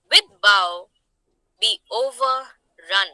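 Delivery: poem reading. Acted. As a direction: read it out phrase by phrase, deliberately. Reads out the poem.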